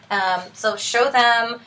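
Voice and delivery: usual speaking voice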